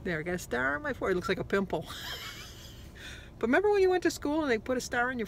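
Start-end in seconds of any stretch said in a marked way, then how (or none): 1.9-3.3 giggle. Real, not acted. Laughing at star on forehead.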